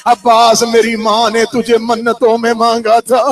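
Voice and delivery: forceful, sustained mourning recitation; impassioned lament preaching. Acted. Zaynab pleads with Abbas as he rides off, impassioned lament.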